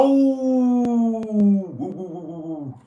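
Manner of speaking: high, loud howl tailing off downwards; werewolf howl. Pretend. Streamer joking he used to be a werewolf, mock howling. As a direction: joke wolf howl, long and wavering. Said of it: "I used to be a werewolf".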